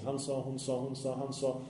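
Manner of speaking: mock gossip. Shown as a demonstration. Imam mimics gossipers to the youth, softly. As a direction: murmur it like passing on rumours. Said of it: "Qil wal-qal betyder vad?".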